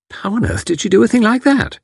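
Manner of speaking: put-on amazed young wife; surprised. A narrator's reading. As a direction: pitch leaps high in astonishment.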